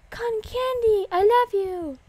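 high, loud adoring squeal; gushing delight. Real, not acted. Player finding beloved cotton candy, gushing delight. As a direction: greet it like a long-lost love.

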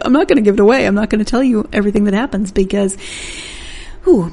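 usual speaking voice

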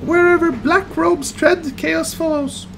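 high squeaky rat voice, slowing down; twitchy squeaking rat voice, slow and ominous. Acted. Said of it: "That was my rat voice".

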